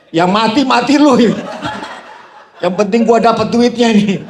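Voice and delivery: loud, high, half-laughing jeer; playful loud taunt, not truly angry; gleeful mock retort. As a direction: crow it out with teasing glee. Mock-tolerant retold inner thought.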